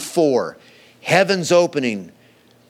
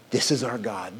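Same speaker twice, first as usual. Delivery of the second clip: soft reverent statement; 5 semitones lower, 7 dB quieter than usual. Acted. Preacher restates the claim softly.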